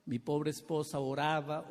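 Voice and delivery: normal talking voice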